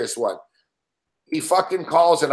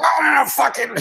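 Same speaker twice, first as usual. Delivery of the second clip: sudden high yelled curse; mock manager rage; 8 semitones higher, slower than usual. Host imitating a raging baseball manager, mock yell. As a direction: blow up like a furious old-school manager.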